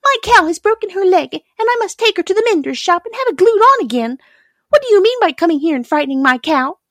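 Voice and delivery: high, loud indignant scolding; angry milkmaid. A narrator's reading. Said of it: "cried the milkmaid angrily".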